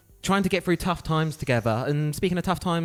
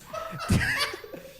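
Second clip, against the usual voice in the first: high laugh; 4 dB quieter than usual. Real, not acted. Laughs at the team name.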